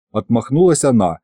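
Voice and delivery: ordinary delivery, nothing marked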